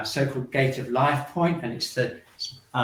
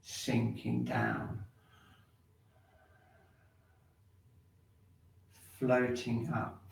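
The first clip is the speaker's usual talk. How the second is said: slow guided movement cues; 2 semitones lower, slower than usual. Acted. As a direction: stretch each cue like a slow tide.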